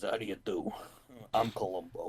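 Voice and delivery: low, quiet gruff detective voice; lightly voiced Columbo; Columbo impression. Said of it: "He says".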